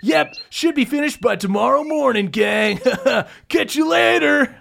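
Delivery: laid-back from the hammock; Funky lounging in his hammock, saying goodbye; lazy boss. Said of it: "gets into his hammock and goes".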